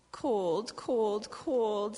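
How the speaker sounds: a touch of shivery complaint; shivering babies. Acted. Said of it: "said the shivering babies".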